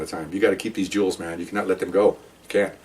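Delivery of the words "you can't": plain reading voice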